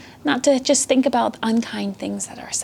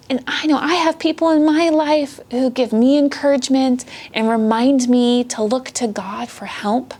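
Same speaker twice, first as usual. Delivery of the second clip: ordinary delivery, nothing marked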